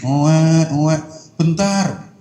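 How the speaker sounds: loud mock baby wail, slow; imitated baby wailing. Baby crying again for its mother, mock wail. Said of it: "Dia nangis lagi".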